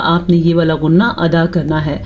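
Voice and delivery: usual speaking voice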